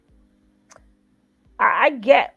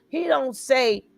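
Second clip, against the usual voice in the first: ordinary talk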